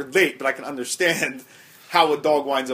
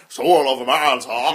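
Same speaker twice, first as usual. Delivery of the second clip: slow put-on character voice; impression; 3 semitones lower, slower than usual. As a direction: stretch it out in a mannered impression. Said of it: "I think it's more Christopher Lloyd".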